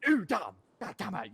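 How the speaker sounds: loud, high burst; acted stunned exclamation. Man she just outwitted, loud high exclamations. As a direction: cry out as if caught by a trick.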